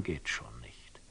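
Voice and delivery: a muttered whispered conclusion; whispered aside. Acted. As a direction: drop into a whisper to yourself. Aside in a monologue.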